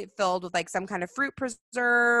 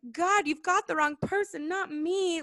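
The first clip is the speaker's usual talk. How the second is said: lightly voiced, much higher protest; a whiny hint of Moses backing away; reluctant Moses; 10 semitones higher than usual. Moses telling God he has the wrong man, high whiny. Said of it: "Moses is like".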